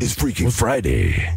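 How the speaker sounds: mock announcer. Announcer echo.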